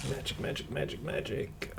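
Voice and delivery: very soft, half-voiced repetition; murmuring to self. Real, not acted. Game master checks for magic, murmuring softly to self. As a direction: murmur it under your breath while searching.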